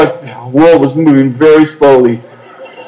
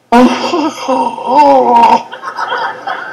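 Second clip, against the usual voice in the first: nervous high giggle; 8 semitones higher, 4 dB quieter than usual. Acted. Nervous laugh at the offer.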